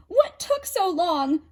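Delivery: high loud impatient cry; exasperated. A narrator's reading. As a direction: demand it crossly. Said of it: "cried Hestia".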